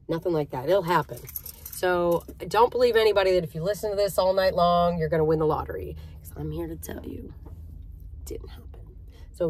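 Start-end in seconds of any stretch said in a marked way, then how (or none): 6.2-8.7 whispered confidentially; conspiratorial whisper. Real, not acted. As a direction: drop to a secret whisper. Confiding the punchline.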